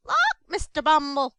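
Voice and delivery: loud, startled exclamation; startled cry. Acted. Mrs Mann startled by the beadle's news, crying out. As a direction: gasp it out, taken aback. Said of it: "cried Missus Mann, starting back".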